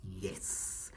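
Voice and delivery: ordinary talk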